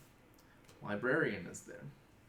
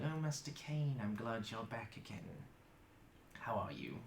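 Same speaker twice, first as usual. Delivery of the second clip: a hint of a hushed prim librarian; lightly voiced librarian greeting Master Kane; 2 semitones lower, 9 dB quieter than usual. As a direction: greet him like a polite old keeper of books. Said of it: "he talks like this".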